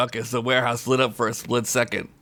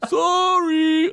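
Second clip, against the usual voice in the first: high, far-off apology; a voice calling from the back; small faraway sorry; distant voice; 5 dB louder than usual. Acted. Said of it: "a voice said from the back".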